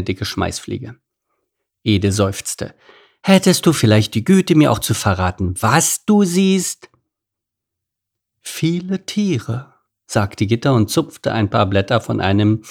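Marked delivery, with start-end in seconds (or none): 3.2-6.9 high start, slowing on the last words; exasperated request. A narrator's reading. Meerkat wanting an answer from giraffe, exasperated. 8.4-9.7 high, slow, drawn-out giraffe voice; unhelpful giraffe. A narrator's reading. Giraffe teasing the meerkat below, drawling.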